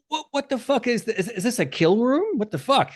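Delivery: high-pitched baffled customer walking in; utterly confused. Acted. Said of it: "as confused as I fucking am".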